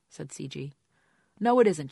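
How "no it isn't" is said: loud, high angry retort; angry outburst. Acted. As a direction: snap back hard, raised voice.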